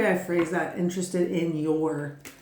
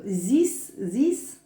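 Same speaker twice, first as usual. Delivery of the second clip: lightly mimicked German accent. Reader checking her own odd slip, light mock German accent. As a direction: try out a hint of a German accent, questioning. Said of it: "Was that a German accent?".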